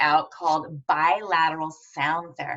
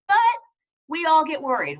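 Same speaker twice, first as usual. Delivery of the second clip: usual speaking voice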